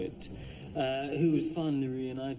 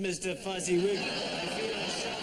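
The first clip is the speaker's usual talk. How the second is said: theatrical period hero; 7 semitones higher than usual. Acted. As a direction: declaim it like a period drama hero. Actor performing a scene in period style.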